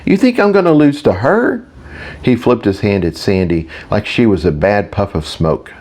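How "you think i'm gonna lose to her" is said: high, incredulous question; disbelieving. A narrator's reading. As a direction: scoff in disbelief, pitch jumping up. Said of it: "Victor laughed disbelievingly".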